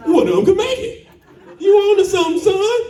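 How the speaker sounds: high, delighted praise; excited approval. Retold or imitated. Father cheering his son's idea, high and excited. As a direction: cheer him on.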